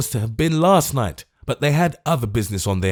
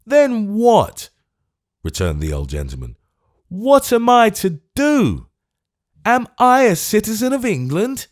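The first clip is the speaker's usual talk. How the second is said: desperate outrage; 12 semitones higher, 5 dB louder than usual. Acted. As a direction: hammer each question home. Old man's desperate questions.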